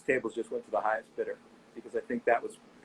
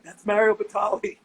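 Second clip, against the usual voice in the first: higher and louder, a disbelieving that's Mario Batali; incredulous exclamation; 8 semitones higher, 6 dB louder than usual. Retold or imitated. Host tells doorman the guest is Mario Batali, incredulous exclamation. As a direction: exclaim it like the doorman missed the obvious. Telling the doorman who it was.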